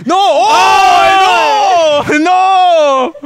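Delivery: loud, high "oh, no!"; shocked outcry. Real, not acted. Host reacting to the guest's dig, loud shocked outcry. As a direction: cry out in scandalised disbelief. Shocked at joke about the gift.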